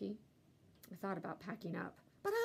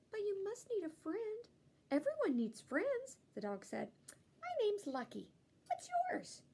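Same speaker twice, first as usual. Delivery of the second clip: eager dog; 11 semitones higher than usual. A narrator's reading. Dog voice pleading friendship.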